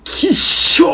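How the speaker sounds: mock disgusted yelp. Boy recalling his mother switching dialects, mock disgusted yelp. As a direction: recall the childhood gross-out with a sharp hushed yelp. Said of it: "思ってて、俺".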